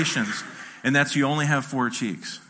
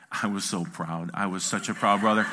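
put-on proud older brother; 6 semitones lower, 3 dB quieter than usual. Son privately telling dad about his brother, quietly proud. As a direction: confide it softly, savoring it. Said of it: "He's like".

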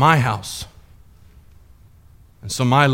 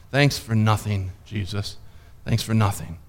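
bitter sarcasm; 6 semitones lower, 7 dB quieter than usual. Retold or imitated. Bitter farewell to Jesus.

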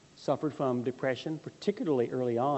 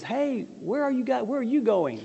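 calling out; 10 semitones higher, 3 dB louder than usual. Acted. Vain Confidence calls to travellers.

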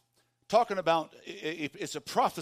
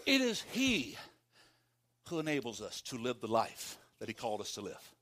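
usual speaking voice

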